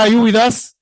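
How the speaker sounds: ordinary delivery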